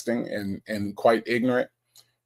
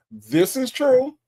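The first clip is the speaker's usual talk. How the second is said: high sing-song agreement; 12 semitones higher, 3 dB louder, slower than usual. Real, not acted. Agreeing with a chatter.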